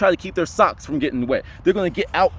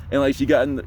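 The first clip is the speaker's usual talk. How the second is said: ordinary delivery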